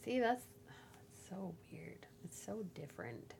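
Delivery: soft breathy whisper. Real, not acted. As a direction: murmur it in disbelief. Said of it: "whispered aside".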